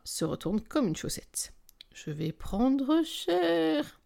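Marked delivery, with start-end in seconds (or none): 1.9-4.0 high, very slow drawn-out words; dreading. A narrator's reading. Narrator sees her boss waiting at the office, dreading. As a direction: draw it out high and slowly.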